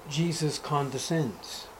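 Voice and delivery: ordinary talk, nothing marked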